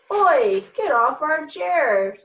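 high, loud storybook protest; cross little animals calling out, drawn out; indignant shout. A narrator's reading. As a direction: order an intruder off your seat. Dog and cat confront rabbit.

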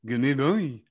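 mock French dog call, put on; bad French accent. Podcaster imitates a French person calling a dog, bad French accent. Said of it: "some French person".

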